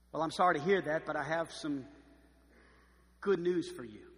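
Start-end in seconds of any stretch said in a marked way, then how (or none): none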